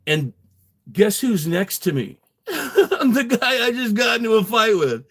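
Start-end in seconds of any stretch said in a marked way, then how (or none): 2.5-5.0 laughing through the line, pitched up high; can't believe who sits beside him, laughing. Real, not acted. Telling the listener about his seatmate, laughing.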